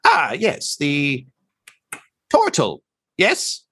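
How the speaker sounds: high, halting bird-creature voice; bird doctor. Acted. Bird doctor greets the newly met Tortle, high creature voice. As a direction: greet them as an odd avian doctor, pitched high. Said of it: "he says".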